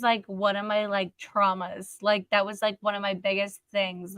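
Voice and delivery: usual speaking voice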